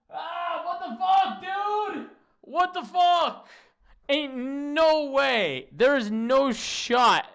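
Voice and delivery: high, loud disbelieving yell, slowing down at the end; outraged shout. Real, not acted. Streamer outraged by the clip.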